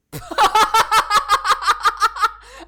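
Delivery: high, loud burst of giggling; loud giggle. Real, not acted. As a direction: crack up helplessly. Loud laugh after cat squeal.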